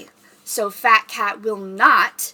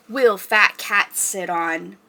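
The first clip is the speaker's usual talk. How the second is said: ordinary delivery, nothing marked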